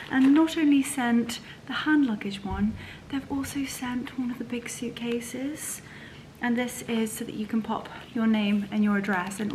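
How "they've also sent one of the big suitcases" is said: whisper. Real, not acted. Admiring gift.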